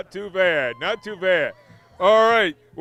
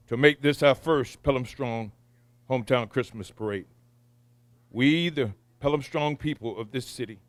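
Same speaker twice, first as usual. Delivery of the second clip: prayer; 4 semitones lower, 3 dB quieter than usual. Acted. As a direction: pray quietly with reverence. Pastor prays the invocation.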